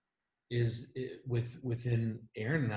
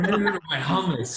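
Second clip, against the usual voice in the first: put-on high, loud wailing child voice; distressed daughter; 7 semitones higher, 10 dB louder than usual. Husband imitating his daughter wailing over her hummus, high and loud. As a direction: wail it like a kid who lost her snack. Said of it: "totally interrupts and goes".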